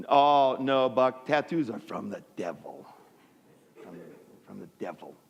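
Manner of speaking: low, grave mock warning; solemn refusal. Acted solemn refusal to brother.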